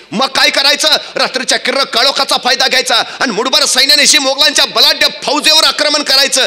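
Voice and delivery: high, driving storyteller's delivery; rousing battle narration. A narrator's reading. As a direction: narrate it like a battle ballad at full tilt. (जोशपूर्ण युद्धवर्णन)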